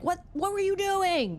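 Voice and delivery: high, sharp mock scolding; angry parent. Parent scolding a child who made a mess, mock angry. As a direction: bark at the kid like an angry parent.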